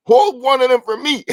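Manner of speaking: high, loud plea to save one; urgent call-out. Retold or imitated. Latecomer to the ticket seller at the door, urgent call-out. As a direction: call it out high and eager, re-enacting the moment. Rushing to grab last ticket.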